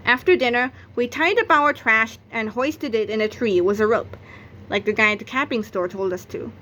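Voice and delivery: ordinary delivery, nothing marked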